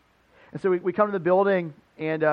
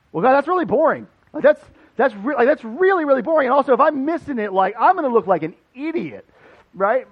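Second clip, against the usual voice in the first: high, loud, whiny protest to God; exasperated retold grumble; exasperated complaint; 6 semitones higher, 7 dB louder than usual. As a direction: throw up your hands at God, louder and higher.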